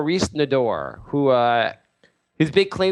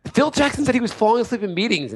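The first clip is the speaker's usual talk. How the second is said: ordinary talk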